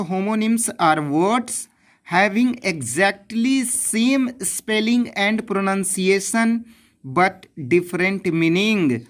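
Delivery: definition read very slowly, word by word; slow dictation. Shown as a demonstration. Teacher giving the textbook definition, slow dictation. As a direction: dictate it so students can copy. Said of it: "definition की बात करते हैं".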